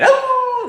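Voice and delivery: high, drawn-out hound howl; a long mournful howl; imitated dog howl. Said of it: "He would howl".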